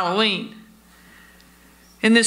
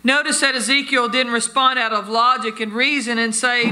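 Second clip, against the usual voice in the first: ordinary delivery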